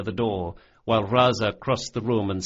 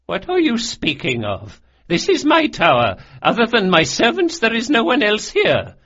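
high, loud voice of an affronted lady; indignant protest; 12 semitones higher, 7 dB louder than usual. A narrator's reading. Old noblewoman insisting the tower is hers alone, indignant. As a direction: protest loudly, voice climbing high.

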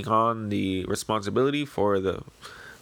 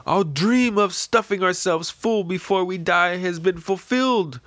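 much higher, louder, strained pleading voice; pleading villagers; 9 semitones higher, 4 dB louder than usual. Acted. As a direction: beg her not to sacrifice herself.

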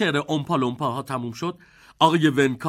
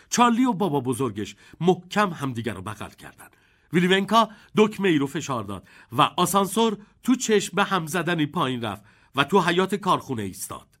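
ordinary delivery, nothing marked

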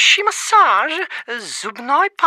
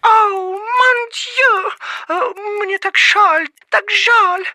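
dramatic lament; 6 semitones higher, 3 dB louder, slower than usual. Acted. Frenchman hears sad news.

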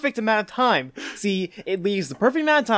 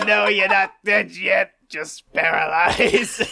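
laughing Green Goblin; 3 semitones higher than usual. Retold or imitated. Podcast host attempting the Goblin line, laughing. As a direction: try the villain voice and crack up. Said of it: "someone give me a line".

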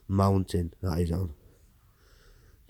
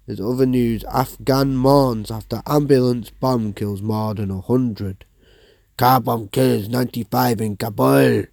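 higher, louder anchor voice; newsreader sign-off; 4 semitones higher, 7 dB louder than usual. Acted. Presenter closing the news flash, anchor voice. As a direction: wrap up the bulletin like a TV host.

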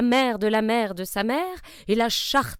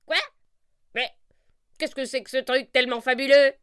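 sorcière stupéfaite; 4 semitones higher, 4 dB louder than usual. Acted.

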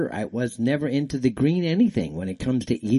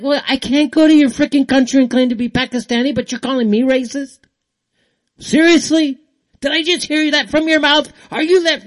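much higher and louder, fast indignant shouting; retold heated retort; 12 semitones higher, 8 dB louder than usual. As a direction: fire back at them with rising outrage. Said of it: "I'm like".

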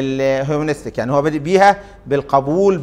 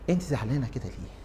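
low, much softer, tender question; understanding, no anger; 5 semitones lower, 13 dB quieter than usual. Shown as a demonstration. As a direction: ask it gently, fully understanding. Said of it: "متفاهم بلا غضب".

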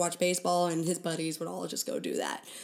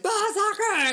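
excited; 6 dB louder, slower than usual. Retold or imitated. Excited cry about soccer.

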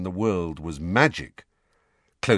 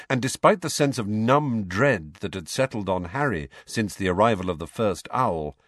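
ordinary delivery

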